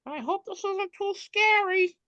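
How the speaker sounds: very high squeaky little voice; tiny acorn voice. Retold or imitated. Little acorn at the Nutcracker movie, very high. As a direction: sound small and nervous like a tiny nut. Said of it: "the little acorn says".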